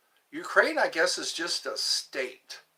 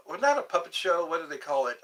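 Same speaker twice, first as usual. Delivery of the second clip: normal talking voice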